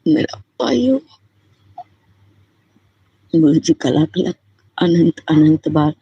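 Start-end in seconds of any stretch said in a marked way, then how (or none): 0.1-1.0 lifted, trembling plea; voice breaking high with emotion. Real, not acted. Devotee calling Guruji her own father, voice cracking. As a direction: let the voice crack upward on the words.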